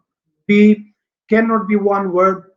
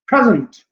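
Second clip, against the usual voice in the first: "present" with PRE punched, second syllable dropped; stress on first syllable; 2 semitones higher than usual. Shown as a demonstration. Teacher showing a two-syllable noun, first syllable stressed. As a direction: lean on the start of "present", let the end fall. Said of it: "पहला जो syllable है वो stress होगा".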